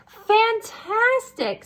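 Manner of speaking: high, slow gushing praise; mock amazement. Elizabeth flattering the dragon, gushing and high. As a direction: lay the praise on thick.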